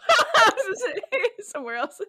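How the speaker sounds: high, laughing giggle. Real, not acted. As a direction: laugh through the words. Laughs at own joke.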